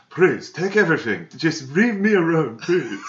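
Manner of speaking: high pleading mock accent; hammed-up pleading voice. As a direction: plead like a terrified hostage. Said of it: "he's like".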